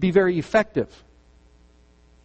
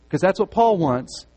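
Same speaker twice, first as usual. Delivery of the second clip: usual speaking voice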